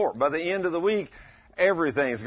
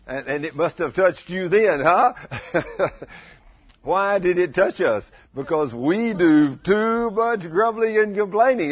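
loud, rising preacher's challenge; preaching at full force; 4 semitones higher, 7 dB louder than usual. Acted. Preacher challenging the congregation about grumbling, loud and pressing. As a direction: press the point home to the congregation.

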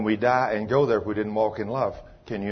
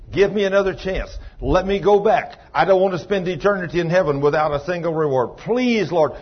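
high, loud begging plea; desperate pleading; 6 semitones higher, 6 dB louder than usual. Retold or imitated. Howard begging the Lord to send him back, loud desperate pleading. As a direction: beg for a second chance with everything. Howard begs God to return.

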